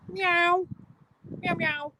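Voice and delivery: very high mock meow; high-pitched imitation of a cat. Meowing at a cat.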